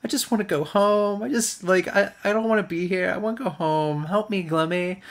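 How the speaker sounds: high, whiny mock-tearful pleading; fake crying. She begs Glimmy to distract him, fake crying. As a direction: imitate a put-on weepy plea. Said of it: "starts crying to distract him and says".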